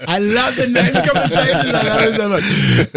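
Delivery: high, laughing delight; laughing host, tickled by the name chat. Real, not acted. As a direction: giggle through it, tickled.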